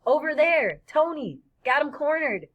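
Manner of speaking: loud, high shouts to the gang; calling out. Acted. Gang member shouts to his leader that he cornered them, loud. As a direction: yell to alert the others.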